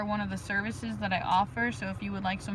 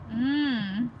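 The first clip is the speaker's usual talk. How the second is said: usual speaking voice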